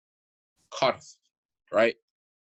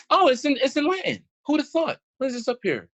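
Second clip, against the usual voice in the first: high, louder mock amazement; sarcastic surprise; 12 semitones higher, 3 dB louder than usual. Real, not acted. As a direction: act amazed that it's in Latin.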